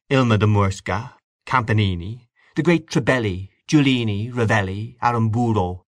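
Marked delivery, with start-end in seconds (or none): none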